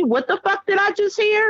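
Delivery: high mock-outraged question; indignant mother. Mother walks in on her boys, high indignant demand.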